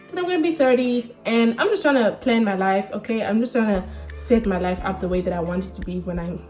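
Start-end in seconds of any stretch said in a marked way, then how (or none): none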